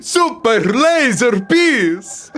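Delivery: laughing squeaky voice. Pretend. Laughing high mock voice.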